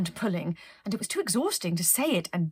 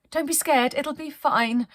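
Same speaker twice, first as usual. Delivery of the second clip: higher, louder cheery captain; chirpy captain calming her crew; a plucky captain reassuring everyone; brave reassurance; 7 semitones higher, 5 dB louder than usual. Acted.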